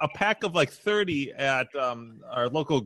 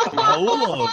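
dramatic Spanish call; 4 dB louder than usual. Pretend.

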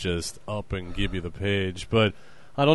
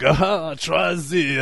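put-on French waiter accent; 5 semitones higher, much slower than usual. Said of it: "he's French".

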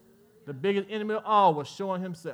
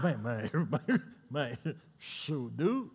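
laughing under breath; 7 semitones lower, 6 dB quieter, much slower than usual. Real, not acted. Laughing at Tyson's fearsomeness.

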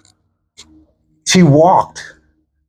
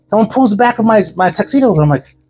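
ordinary talk

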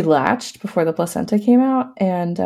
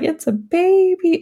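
much higher, slow, tearful awe; tearfully amazed child; teary wonder; 10 semitones higher than usual. Retold or imitated. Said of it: "Ella's crying".

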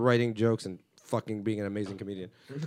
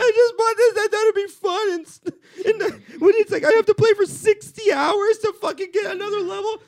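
mock crying whine; 14 dB louder than usual. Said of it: "making me cry".

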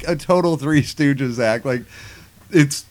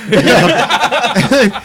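usual speaking voice

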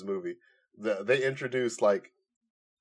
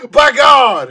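wrestling commentator yell; 14 semitones higher, 17 dB louder than usual. Pretend. Mock Jim Ross wrestling call.

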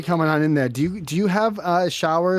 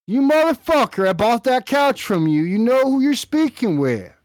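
angry yelling husband; 10 semitones higher, 4 dB louder than usual. Pretend. Angry husband yelling at couch seller on the phone, loud and furious. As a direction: yell it much higher and louder.

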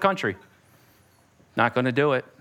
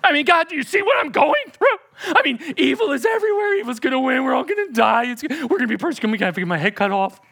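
panicked rant; 7 semitones higher, 4 dB louder, faster than usual. Pretend. Believer panicking to God.

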